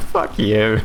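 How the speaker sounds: ordinary talk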